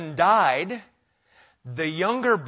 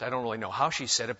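ordinary delivery

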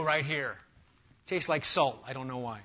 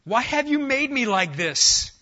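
higher, louder, slow complaint; slow, raised protest of the clay; indignant clay pot; 8 semitones higher, 7 dB louder, slower than usual. Acted. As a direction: whine up at the potter. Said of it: "what does molded say to the molder".

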